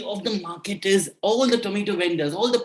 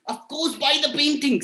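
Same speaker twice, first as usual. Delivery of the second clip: insistent minister; 7 semitones higher than usual. Acted. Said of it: "said the minister".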